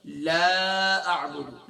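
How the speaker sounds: لا held long with a stretched alif; a slow recitation of لا اعبد; drawn-out la. Shown as a demonstration. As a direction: stretch the لا slowly and fully. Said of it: "لا کے معنی نفی کے ہیں".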